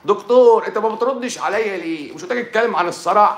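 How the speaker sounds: high, loud, whiny put-on voice; nagging viewer. Viewer nagging the doctor about epilepsy, high and loud whine. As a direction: complain like an impatient fan.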